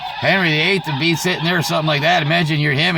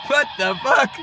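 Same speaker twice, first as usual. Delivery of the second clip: higher, startled what; mock shocked; 10 semitones higher than usual. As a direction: caught off guard by the lock. Locked in the room.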